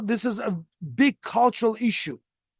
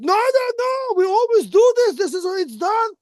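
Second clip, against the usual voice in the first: much higher, louder mock landlady voice; mimicking the landlady; 12 semitones higher, 5 dB louder than usual. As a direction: caricature her brushing off the worry. Landlady dismisses fire worry.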